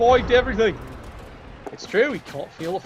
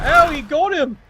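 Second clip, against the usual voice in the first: loud, high delighted shout, slowing on the repeat; real commentator joy, loud and high; excited cheer; 6 semitones higher, 6 dB louder than usual. Real, not acted. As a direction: celebrate the knockdown. Commentator celebrates a hit.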